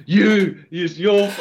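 sudden loud snarl; angry villain outburst. Retold or imitated. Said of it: "he goes full on Doctor Doom".

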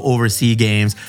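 usual speaking voice

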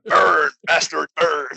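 "Burn" and "bastard" slow and loud on hard R's; exaggerated hard R. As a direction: drag out the R in "Burn".

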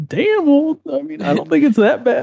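high, wounded little voice, lightly played; squeaky mock-offended defense; mock hurt defensive reply. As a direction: act offended but keep it light. Own hurt reply retold.

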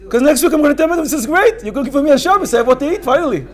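high, loud and thrilled; excited. Acted. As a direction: gush with delight at the free meal. Voices the delighted gentile.

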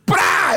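sudden explosive tearing noise; imitated rip. Waxer rips mid-lecture, imitated rip. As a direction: burst in with a ripping sound.

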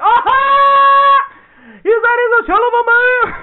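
high, loud mock outburst; shrieking her gotcha back at him; mock shout. Fiancee yelling at him about her dad, high and loud. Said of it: "And she's like".

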